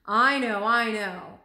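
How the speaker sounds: loud, impatient cutting in; impatient interruption. A narrator's reading. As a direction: brush him off, he's heard it all before. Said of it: "interrupted Marco".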